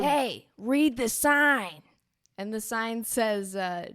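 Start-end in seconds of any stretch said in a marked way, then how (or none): 0.0-1.8 slow, loud, raised-pitch grumpy shout; curmudgeonly stranger. Acted. Curmudgeonly local tells off a lost adventurer, loud and cranky. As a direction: cut in loudly, drawing out each word crossly. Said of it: "it seems to be curmudgeonly".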